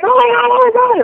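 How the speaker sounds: high, loud mock scream of panic; shrill-pitched, frantic retold shriek; imitated screaming. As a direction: mimic a mom screaming in alarm. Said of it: "are screaming like".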